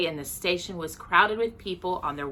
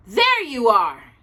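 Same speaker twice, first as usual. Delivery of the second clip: higher, louder, slow announcement; triumphant; 4 semitones higher, 7 dB louder, slower than usual. Acted. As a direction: crow over being right. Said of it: "he announced triumphantly".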